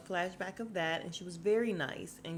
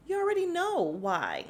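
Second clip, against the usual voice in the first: higher, louder, knowing jab; pointed retort; 10 semitones higher, 6 dB louder than usual. Retold or imitated. Garcelle telling Sutton she knows why, pointed. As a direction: lean on it like the answer is obvious. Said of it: "So she was just like".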